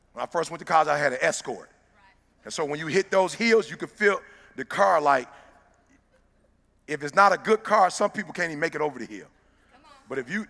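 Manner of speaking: loud, driving preacher delivery to a crowd; motivational preaching. Acted. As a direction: push every point out to the room like a pep sermon.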